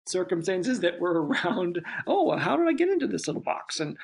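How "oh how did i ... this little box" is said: much higher and louder quick fish voice; a goldfish puzzled at being in a box; little fish voice. Retold or imitated. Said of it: "even little voices".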